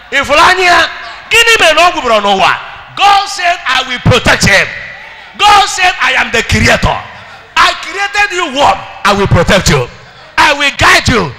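loud, high preaching cry; rising shouted sermon; full-force preaching. Acted. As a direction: preach it out to the whole church.

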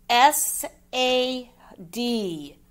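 slow letter names in "S A D", a gap after each; spelled out. Shown as a demonstration. Teacher checking pupils' work, "S A D" spelled slowly.

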